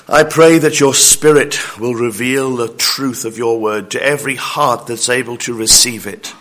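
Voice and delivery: hushed, low opening prayer; quiet pastoral prayer. Acted. Opening prayer before sermon.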